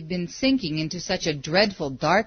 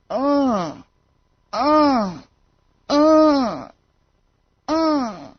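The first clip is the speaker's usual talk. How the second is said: higher, louder eerie groans; ghostly groaning; 6 semitones higher, 5 dB louder, much slower than usual.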